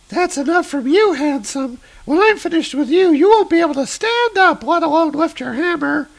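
very high, sultry-teasing villainess; Vertigo villainess voice. Acted. Vertigo teasing Thor before her attack, very high teasing voice. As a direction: purr it high like a taunting femme fatale. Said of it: "this woman called Vertigo".

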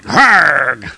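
loud short lunging roar; battle cry. Acted. Thor leaping at the villain, loud battle cry. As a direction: throw out a loud grunting yell. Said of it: "Thor leaps forward, going".

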